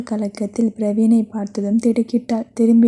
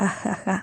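whispered laugh; 8 dB quieter, much slower than usual. Acted. Ashok mocking laugh.

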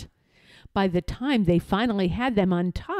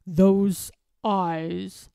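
muttering. A narrator's reading. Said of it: "muttered Droon".

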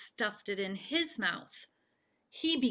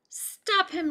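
high, loud crowd yell; 10 semitones higher, 4 dB louder than usual. A narrator's reading. Townspeople yelling to stop Bullfrog. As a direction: call out to catch him. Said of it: "yelled the people".

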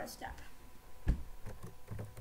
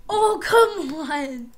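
frustrated shout; 10 dB louder, much slower than usual. Real, not acted. Frustrated after a fall.

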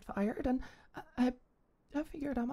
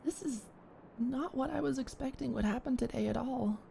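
ordinary talk, nothing marked

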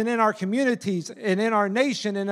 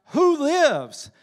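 mock pastor's call, pitched up; shouted call; 5 semitones higher, 3 dB louder than usual. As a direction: call it out like a preacher. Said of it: "he shouts out".

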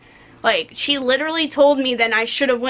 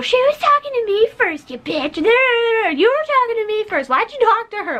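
mocking a Karen; 10 semitones higher than usual. Employee mocks Karen-type customer complaining about being served second, high whiny voice. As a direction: whine it like an entitled customer demanding attention. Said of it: "as a Karen".

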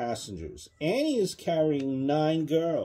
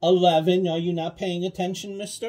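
higher, quick mock-stern tease; mock scolding, higher and louder; playful scolding; 7 semitones higher, 6 dB louder, faster than usual. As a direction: tease the child for not listening. Parent playfully scolding child.

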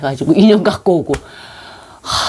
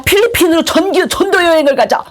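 high, hurried mock enthusiasm; overexcited rallying; 13 semitones higher than usual. Student urging a mission trip to the Philippines, overexcited. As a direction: cheer the group on, carried away.

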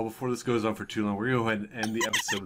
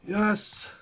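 high excited whisper; 7 semitones higher than usual. Real, not acted. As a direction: cheer under your breath.

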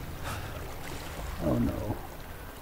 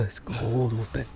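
hushed; 8 semitones lower, 13 dB quieter than usual. Pretend. Shivering in the snowy woods.

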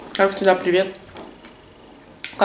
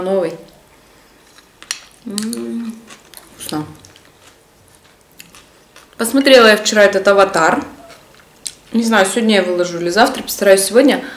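chewing while talking; speaking between bites; mouth full of salad; 5 dB louder than usual. Real, not acted. Seen 4: spoon of salad to mouth at -2, mouth working after.

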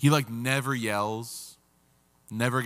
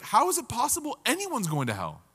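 high-pitched disbelief; acted incredulous question; astonished listener; 9 semitones higher than usual. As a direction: ask it in utter amazement. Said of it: "you're gonna be like".

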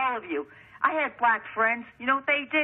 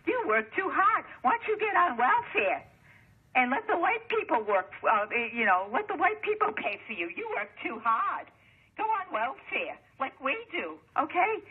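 mocking imitation; 6 semitones higher than usual. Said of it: "you know what they told her".

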